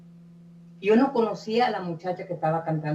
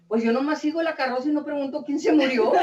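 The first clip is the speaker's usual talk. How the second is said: quick, with a laugh breaking in; laughing delivery of the punchline; laughing through the punchline; 5 semitones higher, faster than usual. Retold or imitated. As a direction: tell the joke's last line while starting to laugh. Punchline of a funny anecdote.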